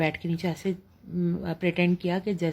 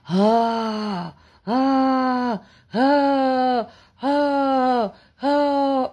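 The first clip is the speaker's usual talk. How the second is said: loud, higher rasping gasps, one by one; dying gasps; 5 semitones higher, 5 dB louder, much slower than usual. Retold or imitated. Retelling the ghost's frightening noise to viewers, loud higher gasping huffs. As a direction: imitate someone's last breaths, loud and high. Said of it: "جیسے کسی کا دم نکل رہا ہو".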